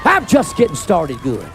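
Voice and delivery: slow, low-pitched preacher's declaration. Real, not acted. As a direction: drop the pitch low and take it slowly. Same line repeated much lower.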